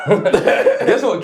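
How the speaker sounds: high, loud question bursting with laughter; laughing build-up. Real, not acted. Storyteller teasing co-host before the reveal, laughing. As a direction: crack up while teasing the reveal.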